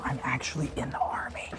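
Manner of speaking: hushed secret whisper; conspiratorial whisper to the camera. Pretend.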